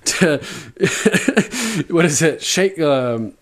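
half-acted Tyrion cracking up mid-quote; laughing retelling. Retelling Tyrion's mock confession at his trial, laughing. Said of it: "And he's like".

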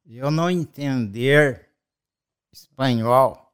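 slow, spaced-out "não entender", each word separate; retold broken speech, stretching out "entender"; imitated halting foreigner. Dad mimicking the shop girl for his child, slow and halting. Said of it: "Mas ela falava assim".

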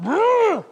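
imitated revving engine, high and loud; mock motor growl; engine rumble. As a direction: buzz out the roar of a car right behind. Engine noise of tailgating SUV.

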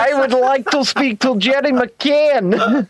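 put-on slow, stilted Russian accent; a put-on Russian, deliberate; lightly Russian-accented request; mock Russian accent. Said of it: "That's a Russian".

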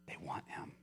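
soft whispered conclusion. Real, not acted. Preacher to congregation, closing line whispered. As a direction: let it fall to a hush.

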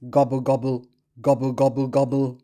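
low, lightly voiced turkey gobble; a hint of a gobbling turkey. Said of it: "Turkey says".

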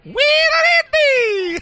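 very high, slow, loud shout; excited shout. Shown as a demonstration. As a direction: belt out the name like a hype call. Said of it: "Or if you prefer Brittany's more muted version".

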